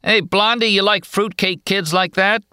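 high, taunting dockside heckler; jeering loafer. A narrator's reading. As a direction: call down mockingly from above. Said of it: "One of the loafers kept calling down".

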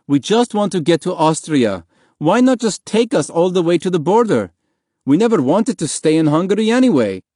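high-pitched, insistent objection; indignant protest. A narrator's reading. Father arguing with soldiers to go on to Austria, indignant protest. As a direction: plead your case indignantly to the guards.

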